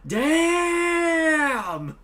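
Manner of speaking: long high exclamation; Smokey and Craig. Retold or imitated. Quoting the Friday clip, loud drawn-out shout. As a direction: stretch one word into a loud, high cry. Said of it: "Smokey and Craig go".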